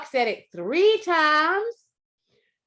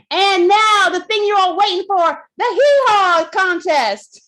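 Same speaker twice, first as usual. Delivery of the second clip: game show announcer; 7 semitones higher, 8 dB louder than usual. Acted. Host announcing the contest to the club, like a game show. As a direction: build the hype like a TV host.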